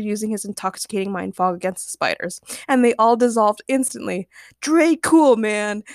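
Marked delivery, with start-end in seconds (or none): 4.6-5.8 loud, high whoop of admiration; cheering. A narrator's reading. Chad congratulates James on beating the spiders, cheering. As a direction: cheer a friend on. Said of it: "Chad cheered".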